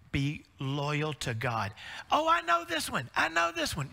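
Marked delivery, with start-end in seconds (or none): 2.1-3.9 put-on high excited little kid voice, much higher; eager child. Father retelling his little daughter's eager reply. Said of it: "child voice in story".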